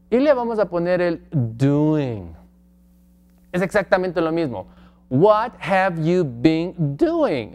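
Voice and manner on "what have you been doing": very slow, deliberate teacher read; slow classroom demo; slow model question. Shown as a demonstration. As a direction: spell it out slowly for the class.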